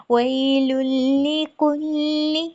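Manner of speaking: कुल्ली with a light, soft क, slow; soft kaf. Shown as a demonstration. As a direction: ease gently into the क of कुल्ली. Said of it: "थोड़ा नरमी से इसको पढ़ेंगे". (कुल्ली का काफ़ नरमी से)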